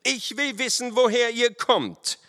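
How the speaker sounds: high, forceful officer's demand; stern demand. A narrator's reading. Lieutenant questions the riders' origin, stern. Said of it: "in strengem Tone".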